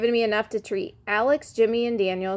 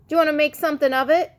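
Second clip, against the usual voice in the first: put-on fast, louder little-kid tough guy; a six-year-old playing the hard man, quick and higher; 6 semitones higher, 5 dB louder, much faster than usual. Daniel, aged six, challenges Elaine in a mock tough-guy voice. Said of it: "in his best tough guy voice".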